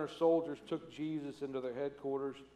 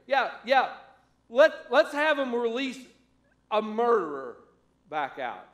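conniving priests; 6 semitones higher, 8 dB louder than usual. Retold or imitated. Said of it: "they let these guys tell them".

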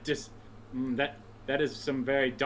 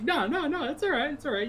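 imitated reassurance; 13 semitones higher than usual. Husband's reassurance acted out.

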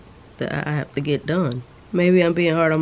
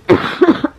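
sudden sharp cough; a real sick cough; harsh dry cough; 13 semitones higher, 9 dB louder than usual. Real, not acted.

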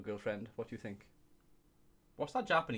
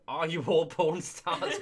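laughing; 6 semitones higher, 8 dB louder, slower than usual. Real, not acted. Laughing mock question.

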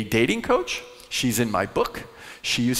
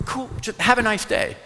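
high, easygoing farewell; cheerful no-big-deal; breezy mock shrug-off; 9 semitones higher than usual. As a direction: shrug off the rejection lightly. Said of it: "or you go".

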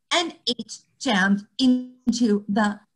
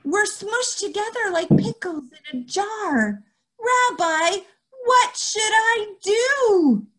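put-on child's voice; 11 semitones higher, 3 dB louder than usual. Child voiced pleading to rabbi.

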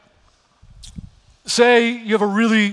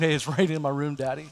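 softly voicing a small child, a touch lower and much softer; a father gently imitating his little girl; cute little daughter; 5 semitones lower, 6 dB quieter, faster than usual. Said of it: "the cutest little voice".

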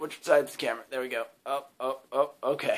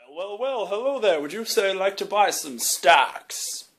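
stock selling dude; 7 semitones higher, 9 dB louder than usual. Acted. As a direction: greet the buyer like a smooth stockbroker. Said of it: "stock selling dude".

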